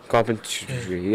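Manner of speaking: "Carpentry" stretched very slowly; slow, drawn-out. Shown as a demonstration. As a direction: spell out "Carpentry" slowly and softly. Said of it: "Carpentry, that's right".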